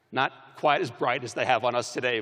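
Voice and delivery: usual speaking voice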